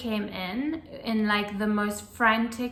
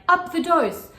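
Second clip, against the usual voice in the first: loud, higher snap of an order; serious order; 7 semitones higher, 7 dB louder than usual. Retold or imitated. Doctor ordering staff to raise the dose, loud and serious. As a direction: give the order with no room for doubt. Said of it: "in, like, a very serious manner".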